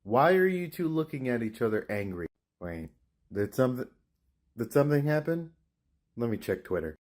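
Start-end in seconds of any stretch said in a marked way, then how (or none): none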